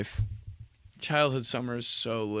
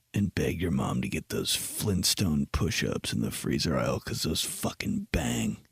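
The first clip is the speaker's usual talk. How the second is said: low confidential advice; 8 semitones lower, 5 dB quieter than usual. Retold or imitated. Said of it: "get him real close to my face".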